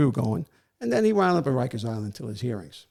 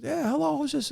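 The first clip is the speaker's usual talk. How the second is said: high-pitched retold query through a door; answering the door; 13 semitones higher than usual. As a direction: imitate someone calling out who is there. Man voiced answering the door.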